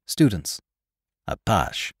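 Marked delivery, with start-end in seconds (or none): 1.3-1.9 Apache said the French way, ah-PASH; French-style Apache, no final ee; Gallicized pronunciation. A narrator's reading. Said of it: "gave it its Gallicized pronunciation".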